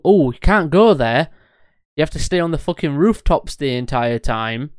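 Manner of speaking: ordinary delivery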